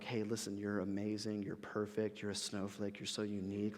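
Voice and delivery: soft, hushed, gushing praise; mock flattery. An imagined God praising Moses, softly mocking. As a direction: list the compliments in a soft, mocking gush.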